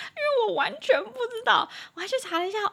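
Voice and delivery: high giggly laughing speech; laughing while talking. Real, not acted. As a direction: laugh helplessly at her own explanation. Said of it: "讲一讲自己都笑".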